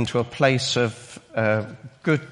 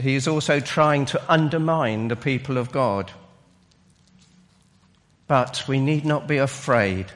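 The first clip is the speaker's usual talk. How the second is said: normal talking voice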